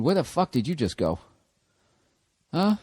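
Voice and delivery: normal talking voice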